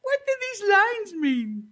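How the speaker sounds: high, slow wail of confusion; baffled bungling superhero. Pretend. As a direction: whine it out as a hopelessly inept hero. Acting the hero baffled by a watch.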